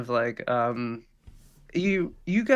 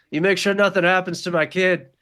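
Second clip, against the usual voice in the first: raised, louder parting order; calling back firmly; 6 semitones higher, 5 dB louder than usual. Acted. Departing father to his kid's companion, raised-voice warning. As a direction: call it back down the hall, pitched up. Character calls back from the elevator.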